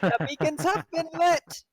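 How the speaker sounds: shouted high epic proclamation. Acted. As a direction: cry it out like lighting a beacon. Quoting epic movie line.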